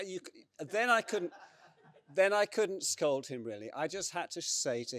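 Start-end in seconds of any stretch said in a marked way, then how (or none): 0.0-3.4 laughing. Real, not acted. Laughs recalling failed scolding.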